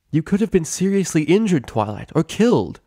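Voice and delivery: angry worry. Acted. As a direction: scold out of fear for her. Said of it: "rage ... vibrato of worry".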